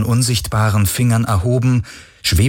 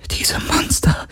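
soft voiceless whisper; frightened woman whispering about monsters; 3 dB quieter, slower than usual. Acted. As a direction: breathe it out in shock. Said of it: "flüsterte sie".

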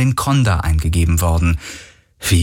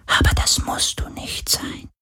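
soft eerie whisper of a sea spirit; wraith whisper; 5 dB quieter than usual. A narrator's reading. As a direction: hush it like a seductive ghost. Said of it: "flüsterte der Geist des Meeres".

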